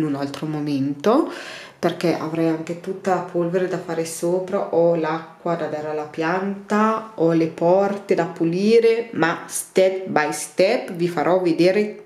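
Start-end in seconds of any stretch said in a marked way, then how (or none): none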